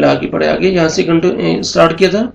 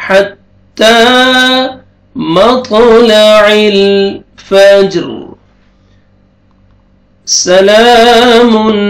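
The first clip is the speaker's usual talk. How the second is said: slow, higher melodic recitation; Quran recitation; 5 semitones higher, 4 dB louder, much slower than usual. Acted. Teacher reciting the verses for students in melodic style. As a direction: chant each phrase slowly and evenly.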